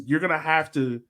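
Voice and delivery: ordinary talk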